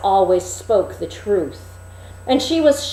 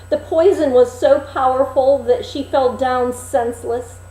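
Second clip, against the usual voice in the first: ordinary delivery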